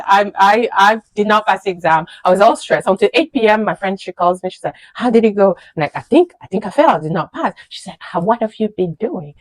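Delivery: usual speaking voice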